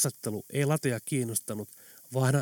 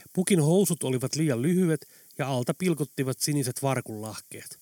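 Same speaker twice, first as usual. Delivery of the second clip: plain reading voice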